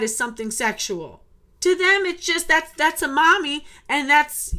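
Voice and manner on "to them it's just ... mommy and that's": heated. Real, not acted. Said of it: "it still makes me mad".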